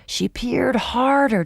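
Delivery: plain reading voice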